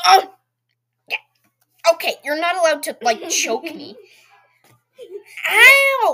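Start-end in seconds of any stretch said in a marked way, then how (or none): 5.5-6.1 real yelp, high and loud; sharp cry of pain. Real, not acted. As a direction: yelp as if suddenly hurt. Sister choking him.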